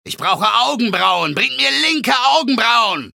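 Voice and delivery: bellowing shout. Acted. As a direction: bellow it like a gladiator. Gladiator bellows a demand.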